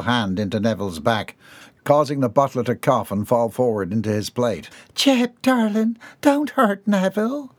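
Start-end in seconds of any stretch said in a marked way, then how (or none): 5.0-7.5 high, slow fretful voice; Bobby Sue. A narrator's reading. Bobby Sue scolding Jeb at the table, high fretful. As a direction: plead with him, high and slow.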